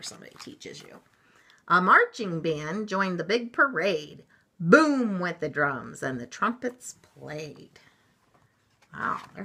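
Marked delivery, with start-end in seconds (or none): 1.7-7.8 slow rhyme, booming then settling; story read-aloud. A narrator's reading. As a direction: give the parade a drum-like beat. Reads the picture book aloud.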